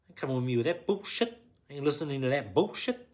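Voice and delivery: acted-out indignant snapping; angry retort. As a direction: push back hard and loud. Acted quarrel with partner.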